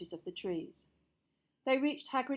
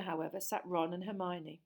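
ordinary delivery, nothing marked

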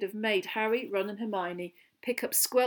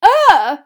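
high, loud "ugh" squeal; disgusted squeal; 10 semitones higher, 19 dB louder than usual. A narrator's reading. Lavender jumping back from the crates, squealing. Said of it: "squealed Lavender Brown, jumping backwards".

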